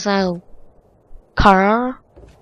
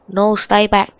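ordinary talk, nothing marked

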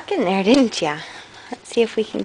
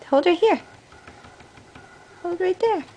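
higher guiding voice; higher coaxing instruction; gentle coaxing; 7 semitones higher than usual. Real, not acted. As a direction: coax his hands into place. Guiding baby's hands.

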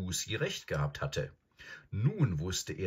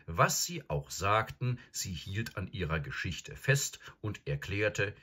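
ordinary delivery, nothing marked

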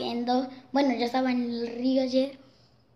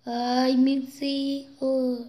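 slow, drawn-out ghostly moan; La Llorona; much slower than usual. Acted. Ghost answers when asked her name, slow moaning. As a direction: stretch the words into a mournful wail. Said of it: "Y me contesta".

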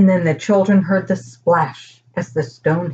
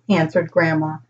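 usual speaking voice

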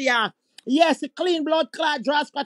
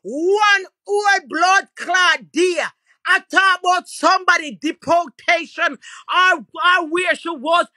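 higher, louder, slow shouted words; angry shout; 4 semitones higher, 5 dB louder than usual. Real, not acted. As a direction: bark it out, still fuming. Continued shouting rant.

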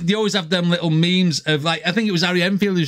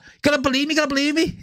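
higher, laughing Scouse plea; mock Scouser pleading, breaking into a laugh; Scouse impression; 8 semitones higher, much faster than usual. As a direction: beg to be believed in a Liverpool accent. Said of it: "Like that".

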